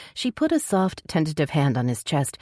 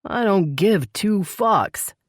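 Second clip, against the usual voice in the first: slow, high-pitched emphatic curse; exasperated male outburst, word by word; blurted outburst; 5 semitones higher, much slower than usual. A narrator's reading. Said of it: "he blurted".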